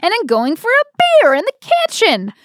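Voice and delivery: amused outrage; excited disbelief. Real, not acted. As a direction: marvel at it. Laughing about playing Betty.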